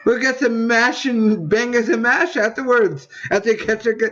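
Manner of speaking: lightly put-on British lady accent, teasing; mock British accent. Bird lady calling to Kevin in a mock British accent. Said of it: "She had a weird British accent".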